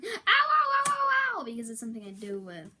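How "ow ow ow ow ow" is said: high, loud playful ow cries; hammed-up ouch; mock pained yelps. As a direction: yelp like cut on glass. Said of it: "want to be going".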